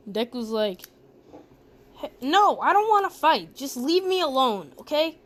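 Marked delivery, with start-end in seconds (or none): 1.9-5.2 higher, louder acted protest; agitated refusal. Deku refuses Bakugo's challenge to fight, acted agitated refusal. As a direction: plead to be left alone, pitch jumping up.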